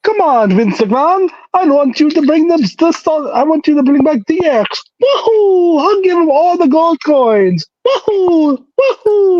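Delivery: put-on high cheerful Mario with Italian accent. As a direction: bounce it out like Mario. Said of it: "That Wahoo was very good".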